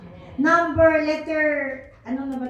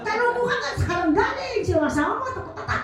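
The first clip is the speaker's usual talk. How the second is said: mocked nagging; 6 semitones higher, 4 dB quieter, faster than usual. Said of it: "Di mo pa nga ako natatanong".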